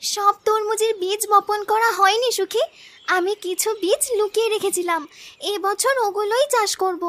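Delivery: put-on soft, reassuring young villager; quietly revealing a saved secret; gentle, careful sister. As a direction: confide it softly to your sister. Sister reveals she hid seeds.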